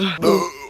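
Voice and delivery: sharp mock gasp; a quick dramatic intake of breath. Stoned teen gasping as the blunt comes his way.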